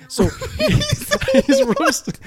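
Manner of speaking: breaking into high laughter; laughing hard. Real, not acted. Host cracks up retelling his brother's stunt, laughing high.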